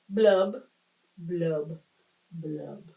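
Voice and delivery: fading glum fish bubbles; gloomy fish blubs. Acted. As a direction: let each blub sink away. Fish's refrain ending.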